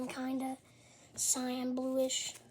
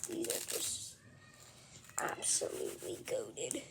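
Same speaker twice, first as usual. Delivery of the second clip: whispering; 2 semitones higher, 9 dB quieter than usual. Real, not acted. Awed over the gear.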